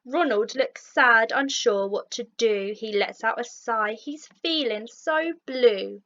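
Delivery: usual speaking voice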